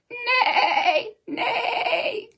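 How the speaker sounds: high, wavering whinny; horse neigh. Shown as a demonstration. Storyteller trying a horse's sound for children, high whinnying. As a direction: attempt a long quavering horse neigh. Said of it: "This one's hard to do, but I'm gonna try".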